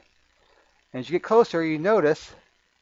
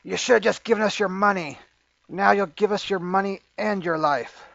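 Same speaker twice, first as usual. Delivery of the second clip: higher-pitched robber making a threat; put-on bandit voice, pitched up; menacing robber; 5 semitones higher than usual. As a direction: demand it as a roadside thug. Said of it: "you hear him say".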